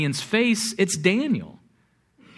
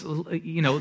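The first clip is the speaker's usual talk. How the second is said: ordinary talk, nothing marked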